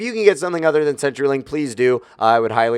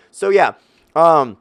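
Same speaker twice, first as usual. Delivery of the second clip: usual speaking voice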